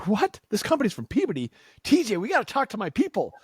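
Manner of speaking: high, excited squeal of surprise; thrilled to find a hometown company; excited disbelief. Retold or imitated. Telling TJ about the Peabody booth, excitedly high. Excited realization at trade show.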